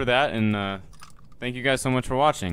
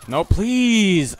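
louder and higher, desperate please; pleading yell; 9 semitones higher, 9 dB louder than usual. Real, not acted. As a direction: cry out no, please. Player begs as he is about to die.

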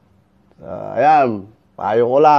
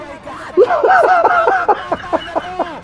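very high, sustained cackle; high drawn-out laugh. Real, not acted. Fight watcher amused by the rooster comparison, squealing laugh. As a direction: let out a long squealing laugh. Sound after rooster remark.

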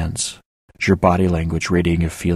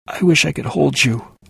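whimpered; 7 semitones higher, 3 dB louder than usual. A narrator's reading. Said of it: "I whimpered".

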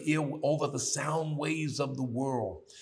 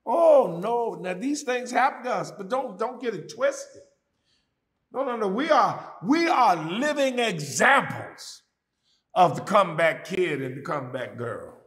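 whooping preacher; 9 semitones higher, 8 dB louder than usual. Acted. Preacher rallying the comeback kids, loud and high. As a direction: whoop it to the peak.

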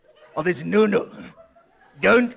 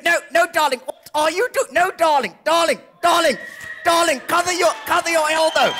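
panicked fussing; 7 semitones higher than usual. Acted. Acted panicked scene with darling.

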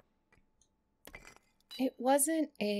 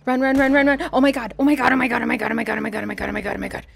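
panicked shouting; 2 semitones higher, 12 dB louder, much faster than usual. Real, not acted. Fleeing enemy in game.

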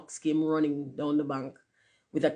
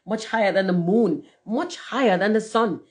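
building excitement; 6 semitones higher, 4 dB louder than usual. A narrator's reading. Rocket about to explode, boasting with rising excitement. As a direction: boast climbing higher and louder.

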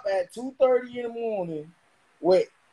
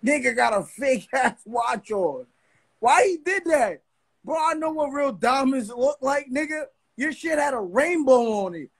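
usual speaking voice

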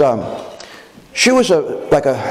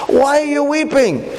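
much higher, lighter angel voice; gentle angel voice; 10 semitones higher than usual. Acted. Angel asking weeping Mary at the tomb, high and light. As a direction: lift it up high like a heavenly messenger.

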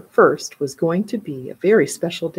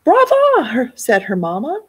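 high bright mother voice; 12 semitones higher, 4 dB louder, slower than usual. A narrator's reading. Mother praises, then redirects.

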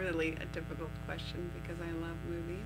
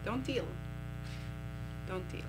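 Russian-accented French; 2 semitones higher than usual. Shown as a demonstration. Practising French aloud, in a mock Russian accent. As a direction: punch "Don't ill" in a mock Russian accent. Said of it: "This is the Russian, French".

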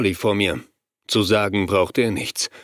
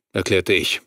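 normal talking voice

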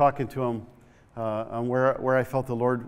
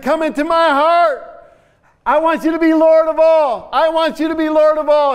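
loud repeat; 11 dB louder than usual. Retold or imitated. Old man echoing the prayer, shouting.

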